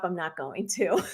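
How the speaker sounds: laughing. Real, not acted. Defiant joke, laughs through it.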